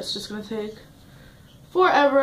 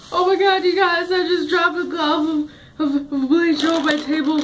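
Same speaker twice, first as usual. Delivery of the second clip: real startled outburst, pitched higher; startled alarm; 4 semitones higher than usual. Real, not acted. Telling viewers she dropped a bleach glove, startled. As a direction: blurt out the mishap, higher and alarmed.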